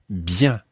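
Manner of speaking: "bien" ending in a nasal vowel, no hard n; nasal French vowel. Shown as a demonstration. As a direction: model "bien" through the nose. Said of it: "another nasal sound".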